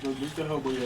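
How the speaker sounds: normal talking voice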